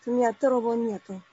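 ordinary talk, nothing marked

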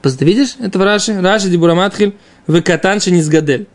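normal talking voice

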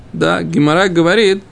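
normal talking voice

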